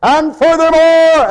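high, slow, drawn-out "furthermore". Shown as a demonstration. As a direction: imitate a preacher lifting his voice. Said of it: "I could raise my voice".

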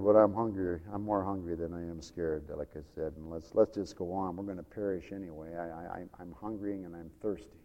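low, soft, secretive murmur; hushed leper. Acted. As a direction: answer under your breath, voice dropped right down. Second leper answers in hushed voice.

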